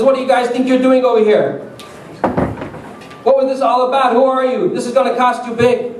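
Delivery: high-pitched indignant scolding; angry confrontation. Acted. Driver confronting the kid who hit his car, angry.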